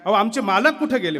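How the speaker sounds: a wife searching for her missing husband; anxious village wife's call; worried wife. Retold or imitated. Worried wife asks sethji.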